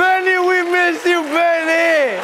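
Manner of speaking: very high, hammed-up wail; comic wailing cry. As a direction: wail it out in mock despair. Comic lament calling out name.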